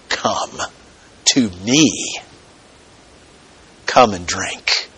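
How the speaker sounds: very soft, low, spaced-out invitation; hushed retold cry. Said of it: "He cries out with a loud voice".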